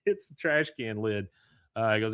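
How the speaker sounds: ordinary delivery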